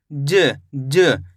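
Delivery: ज said twice, the second ज clipped short with no full vowel; ज, ज with the final sound cut to half; half final letter. Shown as a demonstration. Teacher showing children the half final letter, clipped and low. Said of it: "last वाले अक्षर का उच्चारण अपन आधा करते".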